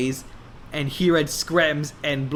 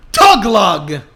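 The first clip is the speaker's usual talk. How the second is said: dramatic reveal; 4 semitones higher, 9 dB louder than usual. A narrator's reading.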